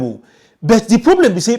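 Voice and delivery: ordinary delivery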